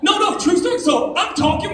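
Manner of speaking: normal talking voice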